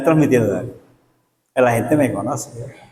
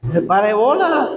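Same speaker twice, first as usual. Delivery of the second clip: usual speaking voice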